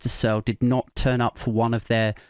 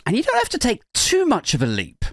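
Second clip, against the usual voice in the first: usual speaking voice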